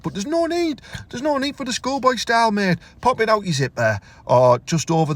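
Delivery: high, disapproving telling-off; mock scolding. Lad tells old man off about schoolboy style, high disapproving. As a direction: tut at him like a stern mate.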